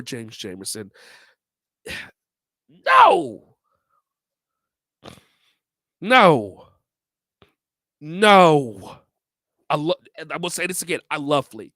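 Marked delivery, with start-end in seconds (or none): 2.8-9.0 loud, high repeated refusals; emphatic no. Real, not acted. Vlogger rejecting [name] at number two, loud emphatic no. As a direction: shout no, no, no.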